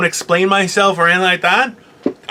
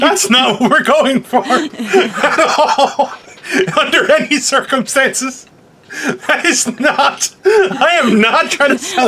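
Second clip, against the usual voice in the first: talking through laughter, higher and slow; laughing protest; 5 semitones higher, slower than usual. Real, not acted.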